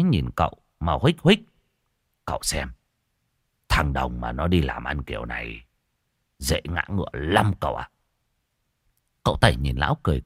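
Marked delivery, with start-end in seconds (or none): 2.2-7.9 low, soft voice, leaning in; low confidential old man. A narrator's reading. Old man confiding to the young man, low and soft. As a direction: murmur a knowing warning.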